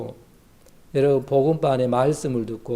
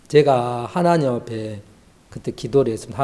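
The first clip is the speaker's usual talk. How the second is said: ordinary talk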